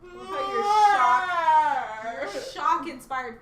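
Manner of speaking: loud slow shout; yelling out the reaction. Real, not acted. Reacting loudly.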